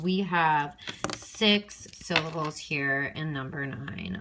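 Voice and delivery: normal talking voice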